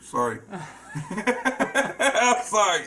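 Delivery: sorry through giggles; laughing it off; laughing apology. Real, not acted. Laughing apology over name flub.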